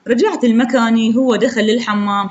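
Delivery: usual speaking voice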